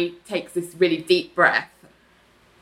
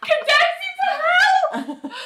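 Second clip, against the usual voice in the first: mock condemnation; 12 semitones higher than usual. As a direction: cry it out like a playful verdict of doom. Mock curse on behalf of Team Greg.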